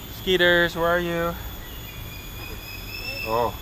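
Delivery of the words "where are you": ordinary talk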